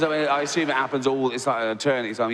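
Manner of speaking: usual speaking voice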